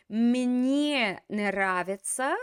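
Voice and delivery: «Мне нравится.» said very slowly, each word apart; slow corrected phrase. Shown as a demonstration. Teacher slowly models Мне нравится for the student. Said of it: "Remember, something is pleasing to you".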